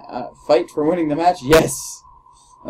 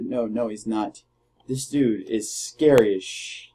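ordinary talk, nothing marked

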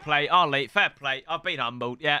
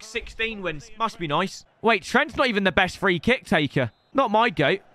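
ordinary talk, nothing marked

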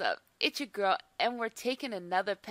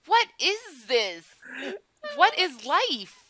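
despairing disbelief; 6 semitones higher, 6 dB louder, slower than usual. Real, not acted. Dismay at failed pet.

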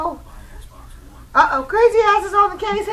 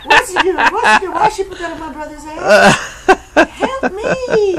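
laughing shout; 8 dB louder than usual. Real, not acted. Laughing at cats' crazy eyes.

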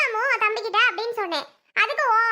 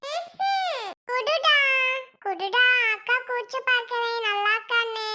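put-on little girl, coaxing; 3 semitones higher, slower than usual. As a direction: wheedle like a small girl. Plays little girl coaxing baby brother. (கெஞ்சும் சின்னப் பெண் குரல்)

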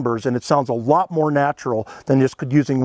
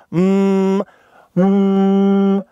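a steady held hum, lips closed; humming demo; 3 semitones higher than usual. Shown as a demonstration. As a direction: hum one even tone like an elk call. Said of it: "I'm gonna hum like this".

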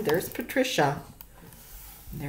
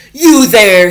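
loud angry yell, higher; 4 semitones higher, 18 dB louder than usual. Acted. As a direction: bark it at the kids caught by the door. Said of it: "he yelled".

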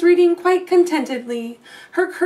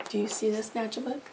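much softer chatty aside; 3 semitones lower, 11 dB quieter, much faster than usual. Real, not acted. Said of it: "asks children to look".

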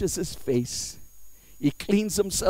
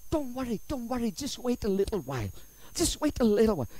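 suddenly soft, calming; soothing reassurance; 4 semitones higher, 3 dB quieter, faster than usual. Pretend. Answering the wife calling out enemies, soft reassurance. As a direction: hush someone's alarm gently.

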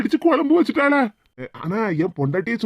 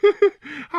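higher short burst of laughter; amused laugh; 5 semitones higher than usual. Acted. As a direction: laugh at the truth dawning. (சிரிப்பு வெடிப்பு)

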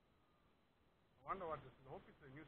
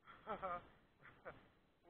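excited laugh; 4 semitones higher, 3 dB louder than usual. Real, not acted. Laughing while fighting fish.

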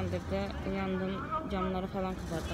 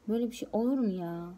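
ordinary talk, nothing marked

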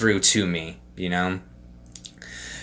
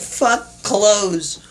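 high, careless shout of freedom; carefree outburst; 11 semitones higher, 3 dB louder than usual. Retold or imitated. Tripping guy telling himself in a high careless yell. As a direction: toss off clothes with a high giddy cry. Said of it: "I'm just like".

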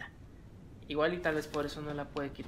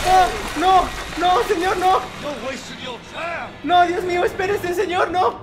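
much higher, louder frantic begging; terrified pleading; 13 semitones higher, 11 dB louder than usual. Real, not acted. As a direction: plead for the attacker to calm down. Suplica al atacante. (súplica aterrada)

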